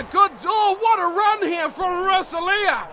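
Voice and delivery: loud sudden lift into the call; lively play-by-play. Acted. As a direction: catch the break with a burst. Live call of a run.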